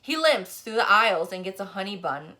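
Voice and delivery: ordinary delivery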